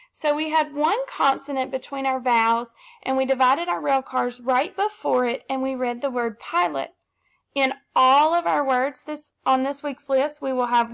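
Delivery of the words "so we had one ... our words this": usual speaking voice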